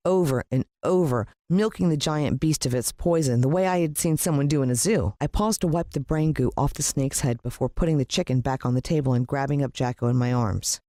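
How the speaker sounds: ordinary delivery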